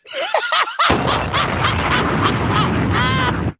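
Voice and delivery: a long, high cackle of real laughter; high laughing burst. Real, not acted. Big laugh at own joke.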